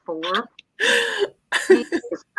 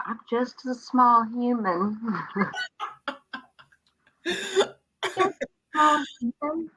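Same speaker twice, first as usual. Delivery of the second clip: put-on child line, higher; 6 semitones higher, 4 dB quieter, slower than usual.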